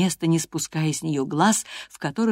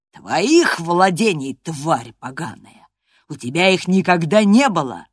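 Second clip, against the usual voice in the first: angry retort; 7 dB louder than usual. A narrator's reading. Master answers the girl's claim to property, angrily. Master furiously insults the girl.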